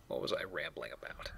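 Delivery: breathy whisper to himself; half-lost whisper, wondering aloud; whispered aside. Real, not acted. Player whispers to himself after a tangent.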